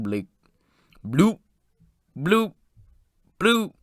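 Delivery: slow bloops, each its own little rising blip; imitated slow loading noise. Reviewer mimicking a gadget loading, slow spaced-out bloops. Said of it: "gloating very slowly, like".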